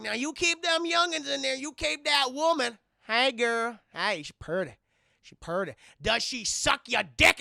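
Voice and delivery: hammed-up country twang, starting high and sliding down; cowboy stranger. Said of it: "this guy in a cowboy hat".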